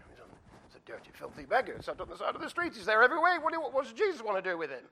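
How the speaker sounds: quick, high, disdainful complaint; acted astonished contempt, gathering speed; scornful crowd grumble. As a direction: build from a murmur to high indignation.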